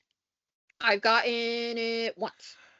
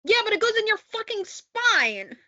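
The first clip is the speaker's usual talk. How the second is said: exasperated; 12 semitones higher, 6 dB louder than usual. Real, not acted. Exasperated reply about epidural.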